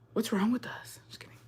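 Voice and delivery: a playful whisper, no voice at all; mock whisper. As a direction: whisper it like a guilty secret. Whispered joke aside.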